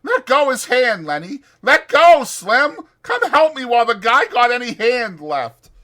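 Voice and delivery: very high, loud pleading orders; desperate shouting. Acted. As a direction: shout over and over. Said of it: "George shouted over and over".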